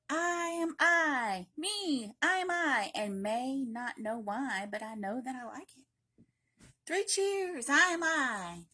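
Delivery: loud, high, triumphant shout. Acted. Child shouting at the sky on their birthday, loud and proud. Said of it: "Shout loud at the top of your voice".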